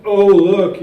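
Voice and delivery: loud, high-pitched mock outcry; mock alarmed accuser. Imagined critics pointing the finger, loud mock alarm. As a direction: cry out in exaggerated alarm, mocking them. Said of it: "and say, like".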